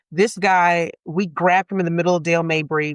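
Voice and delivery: normal talking voice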